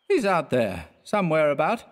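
put-on vague drunk answer. A narrator's reading.

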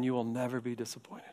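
quick, soft reassurance; hushed promise. Acted. Preacher quietly assuring the congregation. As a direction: lean in and reassure them.

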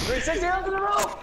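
high-pitched triumphant yell; excited yelling. Real, not acted. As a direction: shout out your stunt as it lands. Celebrating a stunt landing.

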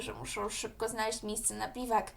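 ordinary delivery

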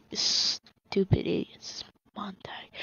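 hushed, slow whisper. Real, not acted. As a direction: whisper it to the chat. Correcting viewers in a whisper.

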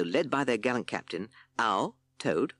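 ordinary delivery

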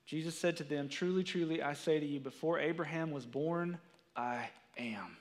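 low, soft and reverent, the words weighed; solemn scripture reading. Acted. Preacher reading Jesus' words to congregation, hushed and solemn. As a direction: read it like holy writ. Reads John verse aloud.

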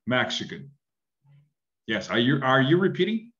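normal talking voice

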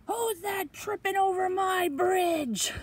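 loud, high, drawn-out troll shout; troll roaring a challenge; mean troll. Acted. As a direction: bellow slowly as a menacing troll.

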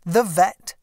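usual speaking voice

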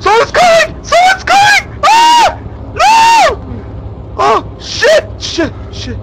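very high, loud cries of real panic; panicked screaming. Real, not acted. Player shrieking that someone's coming, terrified. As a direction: scream the warning, easing off at the very end. Game threat approaching up stairs.

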